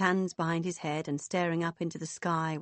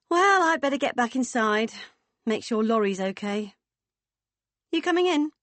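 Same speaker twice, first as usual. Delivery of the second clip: high, loud, brisk leave-taking; booming hearty woman; 6 semitones higher, 6 dB louder than usual. Acted. Woman heading back inside, inviting them, hearty. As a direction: announce it heartily, lifting the final question high.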